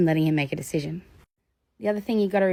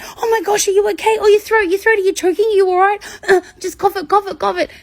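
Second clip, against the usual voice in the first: much higher, louder mock alarm; staged panic; 14 semitones higher, 6 dB louder than usual. Speaker fakes fear the child is choking, high alarmed. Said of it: "I looked really scared".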